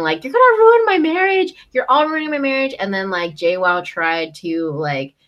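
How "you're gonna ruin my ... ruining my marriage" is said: high, loud mock outburst; hammed-up retold yell, pitched way up; imitated yelling. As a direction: yell it like a melodramatic freak-out. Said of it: "She just starts yelling".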